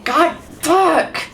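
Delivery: high, sharp curse of frustration; angry outburst. Real, not acted. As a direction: snap it out, really fed up. Hit by respawning enemies.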